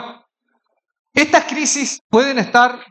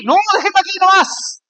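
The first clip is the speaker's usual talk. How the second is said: nervous refusal; 7 semitones higher than usual. Retold or imitated. Pastor refusing to join the ride.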